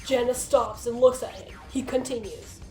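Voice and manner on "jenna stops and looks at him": normal talking voice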